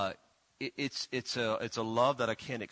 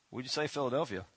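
ordinary talk, nothing marked

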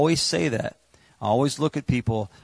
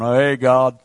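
mock casual greeting; 9 dB louder than usual. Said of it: "He's not going".

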